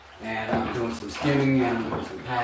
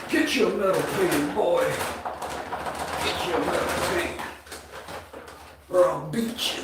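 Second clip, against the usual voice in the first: loud, much higher mock yell of a bullying father; mock angry dad; 10 semitones higher, 5 dB louder than usual. Imitating a commenter's dad ordering him around, loud mock yell. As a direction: ham up a mean dad barking orders at his boy. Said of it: "He said".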